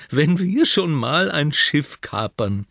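langsam, leicht enttäuscht gedehnt; enttäuschtes Seufzen. Acted. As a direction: seufzt resigniert. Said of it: "seufzt Püppi enttäuscht". (Püppi ist von der Beute enttäuscht, seufzend)

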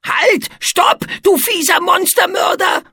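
high, loud outraged monster cry; indignant shout. A narrator's reading. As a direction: yell stop as the towel swings. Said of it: "rief Etze empört". (empörter Monsterschrei)

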